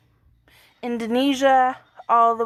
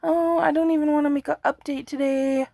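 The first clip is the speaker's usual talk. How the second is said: tired whine; 5 semitones higher than usual. Retold or imitated. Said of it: "I've just been like".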